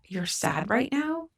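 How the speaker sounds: gently taken aback, drawing out the question; mildly surprised mom, voice rising; retold surprise. Mom surprised by son's confession.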